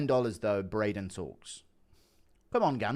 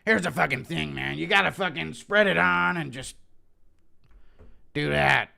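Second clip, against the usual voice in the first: higher, louder tough-guy Strickland voice; Strickland impression; 6 semitones higher, 6 dB louder than usual. Strickland explaining peanut butter and jelly, in a higher, louder rough voice. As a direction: bark it out like Strickland explaining a sandwich. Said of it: "impression of Strickland explaining".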